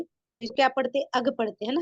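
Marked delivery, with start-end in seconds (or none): 1.0-1.4 short a in अग, no stretching; short vowel. Shown as a demonstration. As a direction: say अग with a quick opening vowel. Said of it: "अलिफ पर मद नहीं देते".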